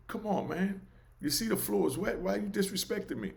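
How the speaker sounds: high, soft, pleading voice of a mild man; gentle George. Retold or imitated. Mild George pleading softly about the wet floor. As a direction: plead softly, hurt and polite.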